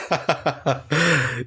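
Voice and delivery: a clumsy forced laugh; faked laugh. Laughing on cue in the scripted read, badly faked. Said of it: "You can tell I faked that laugh".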